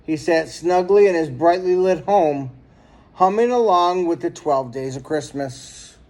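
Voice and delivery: plain reading voice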